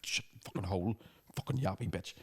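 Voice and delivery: soft, muttered rant; inner rant. Retold or imitated. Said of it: "Do you know in my head?".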